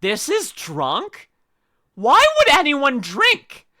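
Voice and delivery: incredulous, rising into a loud why; baffled disbelief. Retold or imitated. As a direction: retell your own shocked confusion. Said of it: "And I'm like".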